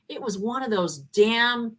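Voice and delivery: usual speaking voice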